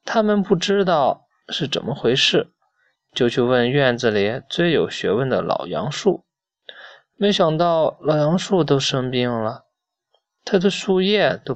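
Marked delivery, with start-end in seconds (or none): none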